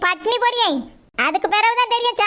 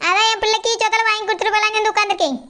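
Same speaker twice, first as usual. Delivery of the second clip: ordinary talk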